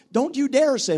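usual speaking voice

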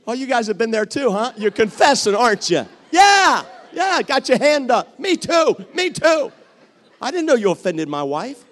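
loud, fast, high teasing of the crowd; preacher teasing the congregation, shouting. Real, not acted. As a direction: call out the confessing audience.

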